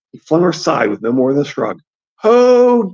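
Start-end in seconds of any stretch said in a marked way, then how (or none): 2.2-2.9 high, loud drawn-out scream. A narrator's reading. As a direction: scream the name in terror. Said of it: "the stable boy screamed".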